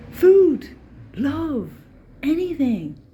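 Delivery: high, squeaky, loud begging dog; a dog pleading for scraps. Retold or imitated. Dog voice continued.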